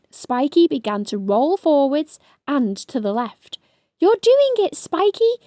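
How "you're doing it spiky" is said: high, louder excited cheer; shouted encouragement. A narrator's reading. Ladybird cheering hedgehog on, high excited shout. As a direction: cheer him on. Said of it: "shouted Stripey".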